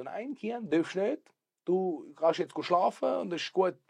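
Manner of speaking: höher und lauter, langsam beschwichtigend; Stimme der Mutter. Retold or imitated. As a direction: rede wie eine Mutter, die ihr Kind beruhigt. Mutter beruhigt Kind. (Mutter zum Sohn, höher und beschwichtigend)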